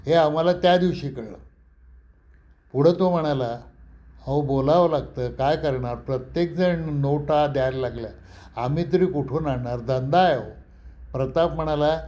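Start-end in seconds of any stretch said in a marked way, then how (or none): none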